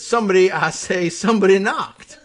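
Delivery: blustery Southern politician; Senator Claghorn. Acted. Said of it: "would typically answer the door with".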